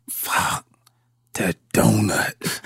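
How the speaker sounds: hushed, horrified whisper, slow; realizing he forgot the donuts, whispered; whispered curse. Retold or imitated. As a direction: breathe it out in dread. Panicked realization in locker room.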